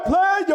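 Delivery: player squeaked up high in mockery; player mocked. Shown as a demonstration. Host mocking the player type, high squeaked word. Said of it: "I'm not talking about the player".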